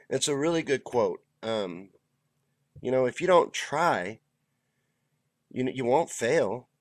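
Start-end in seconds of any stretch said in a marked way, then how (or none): none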